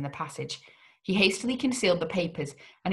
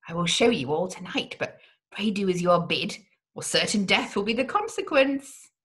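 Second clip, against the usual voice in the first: ordinary delivery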